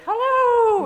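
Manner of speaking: high, loud, panicky "hello"; terrified hello. Retold or imitated. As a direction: blurt "hello" like a scared tourist. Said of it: "in a kind of terrified way".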